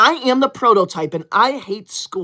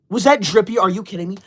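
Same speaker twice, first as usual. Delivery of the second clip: normal talking voice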